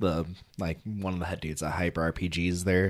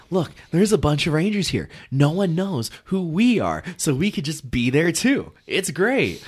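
excited fan voice; 10 semitones higher, 6 dB louder than usual. Retold or imitated. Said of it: "And it's like".

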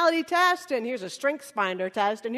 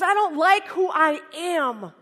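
loud, slow, high-pitched protest; upset outcry; 4 semitones higher, 6 dB louder, slower than usual. Acted. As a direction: cry it out, drawing the words out. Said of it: "Now he's really upset".

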